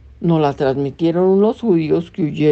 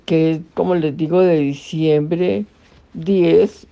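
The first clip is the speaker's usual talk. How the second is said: normal talking voice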